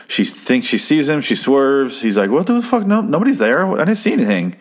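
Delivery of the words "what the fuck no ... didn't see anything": higher-pitched mock bewilderment; baffled hitchhiker. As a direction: imitate a startled, protesting passenger. Acts out startled, baffled hitchhiker.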